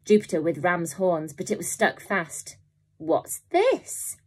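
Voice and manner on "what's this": surprised. A narrator's reading. Robber surprised by cage.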